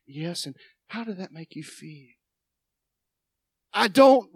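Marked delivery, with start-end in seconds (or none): 0.0-2.1 psychiatrist voice. Retold or imitated. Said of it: "I feel like a psychiatrist".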